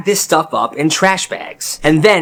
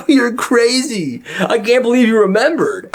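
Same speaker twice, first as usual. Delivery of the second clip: put-on delighted kid voice, much higher; excited kid; 7 semitones higher than usual. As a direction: gush with disbelief and joy. Kid thrilled by brick gift.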